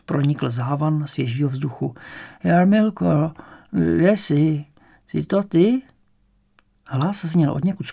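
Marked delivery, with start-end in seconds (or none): 2.4-5.8 put-on higher, drawn-out old woman's call. A narrator's reading. Bedridden grandmother calling for her granddaughter, high old woman's voice. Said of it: "ženský hlas".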